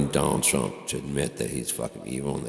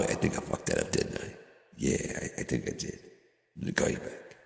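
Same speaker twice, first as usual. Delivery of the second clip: whispered, much softer, sheepish; guilty whisper; 6 semitones lower, 10 dB quieter than usual. Acted. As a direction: whisper like caught on the phone. Voicing guilty phone call.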